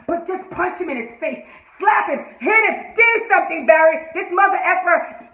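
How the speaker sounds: high, punchy exhortation; worked-up, exasperated shouting; heated fan rant. Real, not acted. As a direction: urge him loudly to hit back. Rant urging hero to fight.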